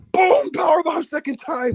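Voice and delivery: high, slow shouted call; excited shout. Real, not acted. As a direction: yell it like the big finishing blow. Hyping a wrestling finish.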